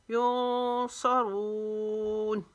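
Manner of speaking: युनसरून very slow, nasal n held, रून stretched; ikhfa, long रून. Shown as a demonstration. Teacher demonstrating ikhfa, युनसरून with sustained nasal and long ending. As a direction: hum the n of युनसरून, then draw out रून. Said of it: "रून को लंबा खींचेंगे".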